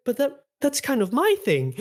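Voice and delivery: very high, stammering protest; playfully wounded, high-pitched objection; super flustered. Retold or imitated. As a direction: imitate a flustered character losing his bit. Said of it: "like, super flustered".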